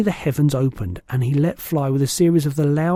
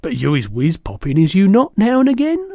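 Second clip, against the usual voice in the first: rising, louder, higher giant query; giant's teasing question; 5 semitones higher, 6 dB louder than usual. A narrator's reading. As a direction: poke fun as the giant. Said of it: "Asked the B F G".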